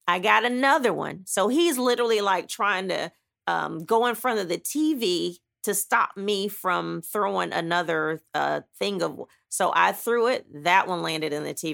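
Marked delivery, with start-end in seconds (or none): none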